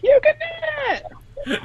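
high-pitched cheering yell; encouraging yell. Acted. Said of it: "and just yell, like".

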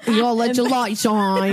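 exaggerated mock-British accent, drawn out. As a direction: ham up the British vowels, slowly. Joins in mocking the accent.

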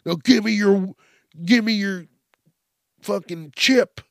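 loud pretend cop demanding a chip; mock demand. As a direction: push him to hand the chip over.

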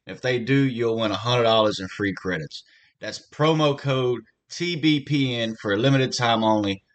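upbeat sponsor read. Acted. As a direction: sell it like a radio spot. Host reads sportsbook ad.

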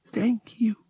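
weak, ragged whisper; soft unvoiced thanks after a trembling spell; breathless whisper. A narrator's reading. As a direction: whisper it, still shaken and out of breath. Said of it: "she whispered, her breath still ragged".

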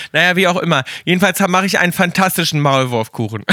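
usual speaking voice

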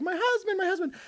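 high, soft sing-song "my husband"; a mock-sweet call of my husband; doting "my husband". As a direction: coo it like a proud wife. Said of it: "you're kind of like".

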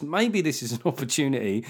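ordinary talk

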